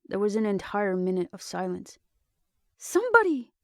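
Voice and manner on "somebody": desperate shout. Acted. Desperate shout into dead radio.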